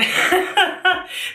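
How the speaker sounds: high giggle; real chuckle mid-sentence; amused laugh. Real, not acted. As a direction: laugh at your own remark. Laughs at being tired of couple.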